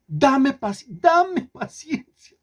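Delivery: high, drawn-out mock begging; whiny plea to God. Preacher mimics believers begging God, high whiny drawl. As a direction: stretch the plea out like a spoiled child. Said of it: "O le piden a Dios".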